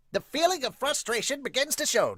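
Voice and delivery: voiced-up narrator line; dramatic narrator. Shown as a demonstration. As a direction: give it a trailer voice.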